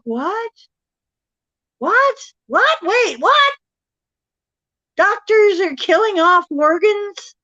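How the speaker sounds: mounting, stunned incredulity; escalating disbelief. Retold or imitated.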